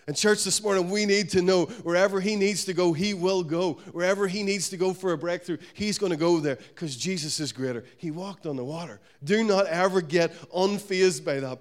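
loud, rising sermon climax; preaching. Acted. Preacher to the church, loud and fired up. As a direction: preach it out with full force.

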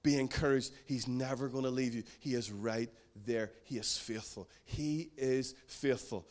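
calm encouragement spoken softly to a friend in need; reassuring. Shown as a demonstration. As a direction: comfort a struggling friend quietly. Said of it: "we've gotta reassure them and say this".

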